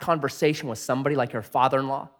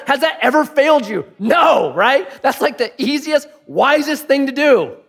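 higher, louder preaching voice; preaching shout; 10 semitones higher, 6 dB louder than usual. Real, not acted. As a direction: press the question on the crowd hard.

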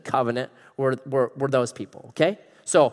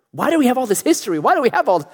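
exasperated question; 7 semitones higher, faster than usual. Retold or imitated. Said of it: "that's what I do".

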